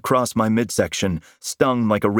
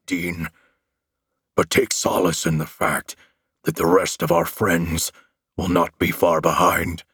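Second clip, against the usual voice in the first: low, menacing monster growl; monster threat; 3 semitones lower than usual. A narrator's reading. Monster promising to end the hero, low growl.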